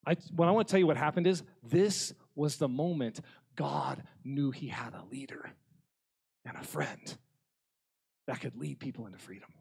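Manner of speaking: usual speaking voice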